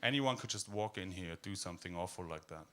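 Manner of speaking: usual speaking voice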